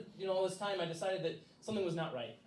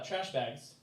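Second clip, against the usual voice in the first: usual speaking voice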